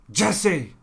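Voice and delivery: high, loud yelled name; one last hammed-up yell; Walt shout impression. Vlogger's final hammed-up Walt yelling for his partner. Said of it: "That was the last time".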